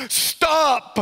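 high, urgent cry of alarm; shouted warning. A narrator's reading. Market woman warning a truck driver about cables, high shout. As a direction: call out to halt the truck. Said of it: "the market woman shouted".

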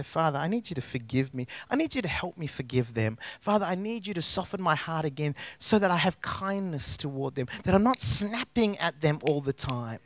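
hushed pleading prayer; humble quiet prayer. Acted. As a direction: pray it gently and sincerely.

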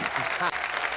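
breathy laugh. Real, not acted. Laughs at own joke.

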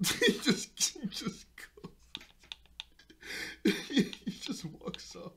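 high, wheezing laugh swallowing the words; helpless giggles between broken phrases; laughing while talking. Real, not acted. Narrator replaying the boy being left behind, cracking up. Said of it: "It's just how funny".

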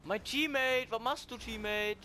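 loud, high call to a teammate, drawn out; exasperated high shout. Real, not acted. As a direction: yell across at the teammate in disbelief. Calling out to his teammate.

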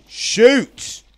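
sudden high yell; startled shout. Real, not acted. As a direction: yell it out in a jolt of alarm. Sudden danger in game.

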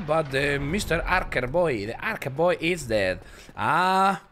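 slow, high cowboy-style English; hammed-up western accent. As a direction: drawl it like a country man in a western. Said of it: "Me está saliendo como un acento como del oeste".

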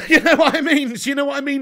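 real laugh spilling into the question. Real, not acted. Streamer laughing at a viewer's transfer question, laughing loud.

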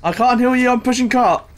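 combat callout. Real, not acted. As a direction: snap it back mid-fight. Mid-fight reply to teammate.

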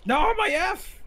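high wail of loss; dismayed high yelp; anguished cry. Real, not acted. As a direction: cry out as the prize slips away. Losing the F in game.

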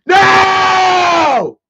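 long, high, very loud "no" scream; imitated scream. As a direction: scream it out like a stunned dad. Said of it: "then he screamed it, dude, just like that".

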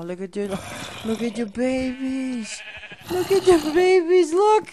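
very high, loud cooing. Real, not acted. Gamer cooing over baby horses, very high baby talk.

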